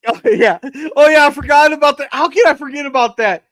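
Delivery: ordinary delivery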